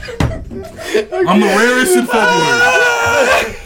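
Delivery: high, slow, cracking with laughter; laughing shout. Acted. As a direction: yell it through laughter. Declaring defiantly, laughing.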